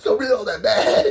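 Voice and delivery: words squeezed out through a laugh; high and loud, breaking into laughter. Real, not acted. Teller cracking himself up over his story, laughing.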